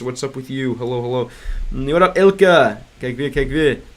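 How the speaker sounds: ordinary talk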